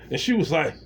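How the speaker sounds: ordinary talk, nothing marked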